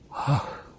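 breathy exhaled gasp. A narrator's reading. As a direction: gasp of revelation. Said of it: "выдохнул Джозеф".

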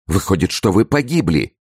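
high, distressed exclamation; despairing cry. A narrator's reading. Wife realising her husband is ruined, in despair. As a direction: cry it as if all is lost. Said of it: "вскричала в отчаянии".

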